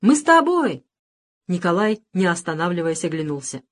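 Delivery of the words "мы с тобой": high loud eager call; eager shout. A narrator's reading. Boys chase Nikolai, eager shout. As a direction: tell him you're coming.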